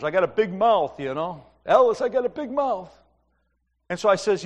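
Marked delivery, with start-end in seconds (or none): 1.6-2.9 higher-pitched TV comedy impression; Honeymooners sitcom husband. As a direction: imitate the blustering Honeymooners guy. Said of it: "Like the guy on the honeymooners".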